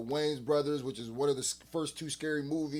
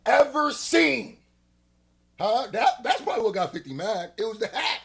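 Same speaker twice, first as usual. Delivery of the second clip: hammed-up disbelief at the hat; loud mocking rant; excited roast; 8 semitones higher, 8 dB louder than usual. Real, not acted.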